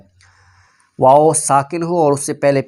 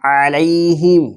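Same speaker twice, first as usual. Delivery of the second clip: غیر المغضوب علیہم chanted slowly, long waw; teacher's slow tajweed chant; slow Quran recitation; much slower than usual. Shown as a demonstration. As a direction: model the phrase with the madd held. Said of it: "اس لیے ہم نے اس کو لمبا کیا ہے".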